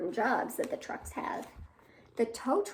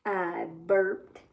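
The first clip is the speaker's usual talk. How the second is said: lower, lightly voiced worm; 7 semitones lower than usual. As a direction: answer like a sheepish little worm. Worm's punchline in the rhyme.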